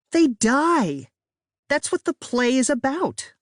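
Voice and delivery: high, loud frustrated cry; exasperated outburst. A narrator's reading. Sister yelling the obvious plot, high and frustrated. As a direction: throw up your hands in disbelief.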